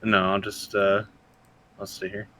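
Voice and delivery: usual speaking voice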